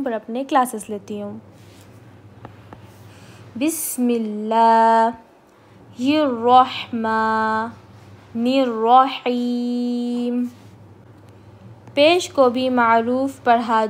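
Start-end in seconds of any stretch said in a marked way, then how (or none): 3.6-10.4 slow, measured Quranic recitation. Shown as a demonstration. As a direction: recite each word slowly with pauses. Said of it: "teacher recites opening before lesson". (آہستہ تلاوت)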